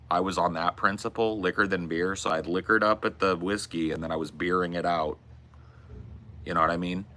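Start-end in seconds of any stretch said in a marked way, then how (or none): none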